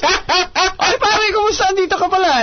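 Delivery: high, laughing eager greeting; excited greeting. Retold or imitated. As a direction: gush over the celebrity, laughing. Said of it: "He goes".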